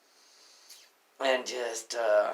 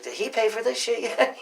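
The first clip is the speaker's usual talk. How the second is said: high, raised-voice demand; retold heated question; 12 semitones higher, 5 dB louder than usual. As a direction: call it out high to a coworker. Retold check with coworker mid-quarrel.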